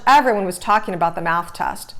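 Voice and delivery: ordinary delivery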